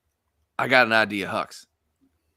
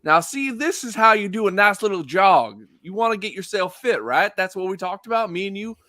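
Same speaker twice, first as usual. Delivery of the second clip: higher, put-on coaching patter; out-loud jogging coach; 9 semitones higher, 3 dB louder than usual. Character coaches Hux on jogging as cover, out loud. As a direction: instruct out loud, for everyone to overhear. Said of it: "he, like, says out loud".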